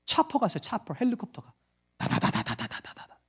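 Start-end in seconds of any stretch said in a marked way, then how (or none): 2.0-3.2 helicopter noise. Retold or imitated. Imitating helicopter rotor.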